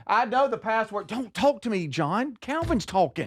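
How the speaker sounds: high, irritated mom voice; snapping mom. Retold or imitated. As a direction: shut the husband down, high.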